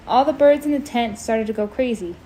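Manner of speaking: plain reading voice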